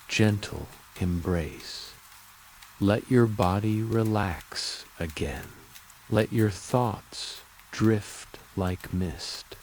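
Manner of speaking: plain reading voice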